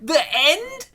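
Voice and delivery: high, far-off squeaky complaint; mock outraged viewer. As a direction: whine it up like a cheated moviegoer. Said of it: "and I'm like".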